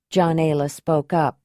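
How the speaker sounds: plain reading voice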